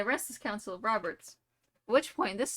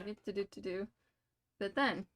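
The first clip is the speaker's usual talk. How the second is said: ordinary talk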